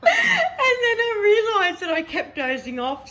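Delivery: high, laughing through the words; laughing while telling. Real, not acted. Telling a friend about dozing off, laughing. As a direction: giggle it out, barely holding it together. Laughing at her own story.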